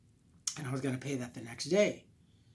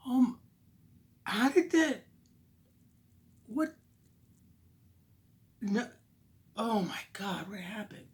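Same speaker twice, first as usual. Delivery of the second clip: much higher, rising panic, then a whisper; shocked disbelief; 7 semitones higher than usual. Retold or imitated. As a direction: squeak it out as the shock hits. Discovering money gone.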